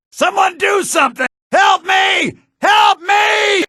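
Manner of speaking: high loud frightened shouts; panicked cry for help. Acted. Victim cries out to bystanders in panic. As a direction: beg anyone nearby to help.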